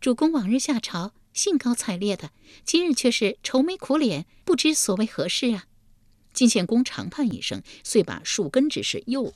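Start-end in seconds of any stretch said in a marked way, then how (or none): 0.0-5.7 high, playful feminine lilt; smiling consort. A narrator's reading. Li Ji asking the gloomy duke what troubles him, smiling. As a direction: coax with a smile in a high voice. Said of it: "笑问道".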